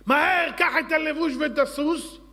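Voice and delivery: urgent royal order. Acted. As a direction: bark the order at Haman. King orders Haman urgently. (פקודה דחופה בקול רם)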